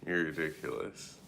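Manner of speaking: ordinary talk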